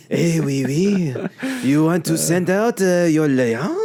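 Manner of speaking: high, loud, slow cartoon Scottish; Scottish accent. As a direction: ham up a Scottish trainer. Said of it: "Scottish or something".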